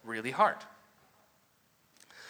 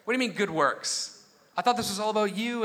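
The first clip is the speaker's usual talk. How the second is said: startled, higher objection; surprised; 4 semitones higher than usual. Retold or imitated. Reacting to the verse, startled and higher. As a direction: stop short as if caught off guard. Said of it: "I'm like".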